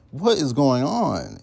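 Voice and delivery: high, loud, drawn-out disbelief; baffled outburst. Acted. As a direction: exclaim it slowly in loud bewilderment. Baffled reaction to nonsensical messages.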